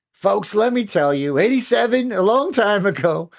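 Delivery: loud, high mock Trump voice; Trump accent. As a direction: play Trump working the crowd. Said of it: "How do you do a Trump accent?".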